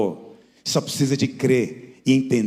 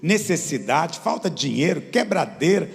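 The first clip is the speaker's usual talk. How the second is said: preaching at full force; 9 semitones higher than usual. Acted. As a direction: preach it to the back row. (pregação inflamada, em voz alta)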